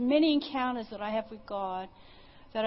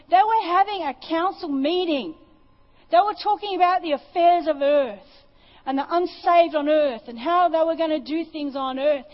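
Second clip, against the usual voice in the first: higher, louder preaching climax; preaching at full force; 6 semitones higher, 9 dB louder than usual. Acted. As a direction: build the wonder, preach it out.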